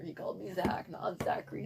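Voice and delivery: hushed aside whisper. Retold or imitated. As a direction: whisper it like a secret grievance. Whispered complaint about teacher.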